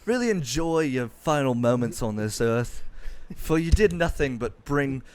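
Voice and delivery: a lightly voiced posh English gent; mock posh English. Mocking a stuffy critic, light posh English accent.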